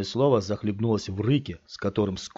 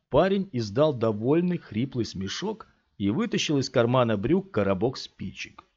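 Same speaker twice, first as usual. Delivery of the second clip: plain reading voice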